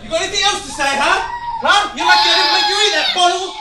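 loud, high taunting shout; bully him, laughing and shouting; wild unhinged ranting. Acted. Father bullying child.

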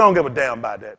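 low, laughing dismissal; dad's low brush-off. Retold or imitated. Dad brushing off the neighbors, low laughing dismissal. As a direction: toss it off low, half laughing.

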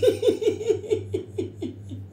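high airy laugh; breathy giggle. Real, not acted. Amused by the six hundred years curse, airy laughter.

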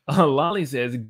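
ordinary talk, nothing marked

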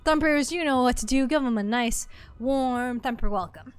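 bright upbeat announcer greeting; streamer shout-out. Acted. Streamer welcoming a new follower to the channel, upbeat announcer read.

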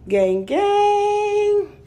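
hype shout-out. Real, not acted. Showing viewers her walk-in closet, playful hype call.

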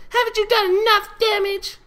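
very high, loud shrill filly yell. Acted. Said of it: "a mint green unicorn filly yelled".

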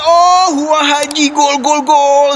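shouting the goal, pitch up; goal call. Real, not acted. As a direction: celebrate the goal at full voice.

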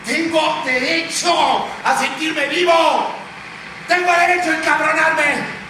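high, emphatic declaration; angry defiance. Acted. Anchor claiming the right to rage, angry defiance. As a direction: proclaim it, rising to a shout.

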